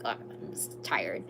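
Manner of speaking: breathy whisper, slow and hushed; whispered aside. Real, not acted. Reader whispers an aside to someone off camera, hushed. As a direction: hush it to someone in the room.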